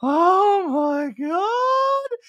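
high, drawn-out mock swoon; hammed-up thrilled wail; titillated. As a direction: gush in a squeaky, stretched-out falsetto. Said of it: "you looked so titillated in this".

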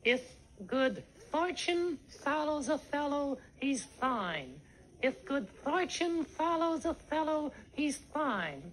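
careful, measured drill, "If" and "fine" with TH; TH for every F. Shown as a demonstration. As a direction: practise "fortune follows a fellow" with TH for each f. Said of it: "E T H instead of F".

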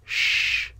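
sh as a long soft hiss; sh sound. Shown as a demonstration. Teacher shows the sh sound to Spanish learners. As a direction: hush sound sh, breath only. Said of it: "se pronuncia sh".

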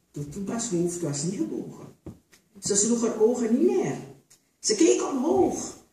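ordinary delivery

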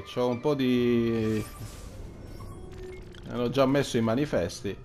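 usual speaking voice

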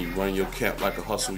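ordinary talk, nothing marked

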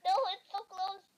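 shouted oh no, high; loud despairing shriek; panicked cry. Real, not acted. Caught or nearly caught.

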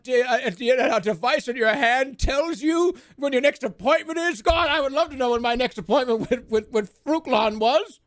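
wide-eyed alien marvelling at a calendar; awed clueless alien. Retold or imitated. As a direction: gawk at every human gadget. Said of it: "Aliens could just be like".

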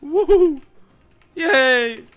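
high, loud victory whoops; cheering. Real, not acted. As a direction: celebrate the win out loud. Cheering a win.

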